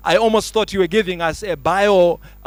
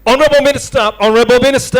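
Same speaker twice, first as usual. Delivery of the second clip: louder, higher, ringing address; calling out; 4 semitones higher, 5 dB louder, much faster than usual. Real, not acted. MC hailing the Honorable Minister before the crowd, loud call. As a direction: hail the minister across the hall.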